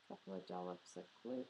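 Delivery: usual speaking voice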